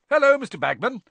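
high, loud, cheery greeting; bright. A narrator's reading. Fred greets Bagman in the pub, bright and loud. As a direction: sing out a cheerful hello. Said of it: "said Fred brightly".

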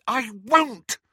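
high, loud refusal; defiant. A narrator's reading. Daughter refusing her mother, high and loud. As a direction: throw it back at her mother.

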